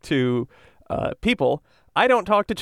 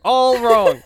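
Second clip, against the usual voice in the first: louder, higher hammed-up despair; dramatic dismay; 5 semitones higher, 5 dB louder than usual. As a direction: moan it like a tragedy. Repeats it more dramatically.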